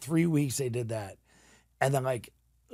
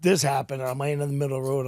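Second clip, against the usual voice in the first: ordinary delivery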